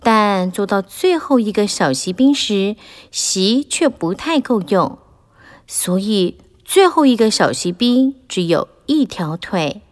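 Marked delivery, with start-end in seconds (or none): none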